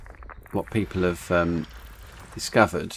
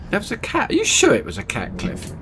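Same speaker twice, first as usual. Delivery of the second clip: squeaky high voice; 13 semitones higher, 3 dB louder than usual. Pretend. Squeaky voice asking Cliff.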